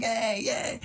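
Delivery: high little cheer at normal volume; mock cheering. Buskers answer the listeners in the window, high mock cheer. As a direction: imitate a pleased performer's high yay.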